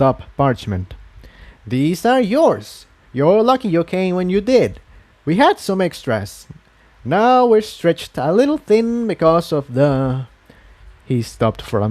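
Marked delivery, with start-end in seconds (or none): 1.7-10.3 a busy elder pony, higher and unhurried at first; kindly but harried townspony handing out gear; lightly voiced Quill Dipper. A narrator's reading.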